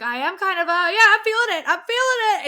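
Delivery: high, loud, escalating admission; retold panicky confession. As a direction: act out losing your cool. Admitting the edible is hitting.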